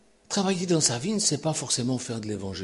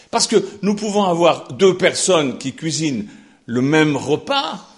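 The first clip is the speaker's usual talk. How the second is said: fervent preaching; 8 semitones higher, 11 dB louder than usual. Acted. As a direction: pound it out from the pulpit. Preaching at full force. (prédication fervente et forte)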